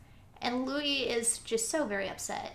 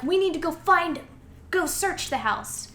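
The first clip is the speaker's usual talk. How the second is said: raised-pitch, louder soldier's command; urgent order; 7 semitones higher, 6 dB louder than usual. Retold or imitated. Soldiers order a house search.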